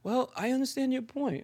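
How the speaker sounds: very soft, placating reply; calm conversation mode. Retold or imitated. Man answering a yelling partner, softly placating. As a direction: answer the yelling in a hushed, understanding voice. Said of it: "even in that conversation mode".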